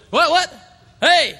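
high loud angry shout; fired-up shout. Acted. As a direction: yell it like a hothead provoked. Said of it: "he's just a little fired up".

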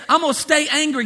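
playing someone who refuses to budge; mock stubborn defiance. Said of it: "If you just stay".

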